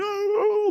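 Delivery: high, held mock sob; mock wail. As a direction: imitate a congregation wailing at an altar call. Imitating noisy crying congregation. (tiruan tangis melengking)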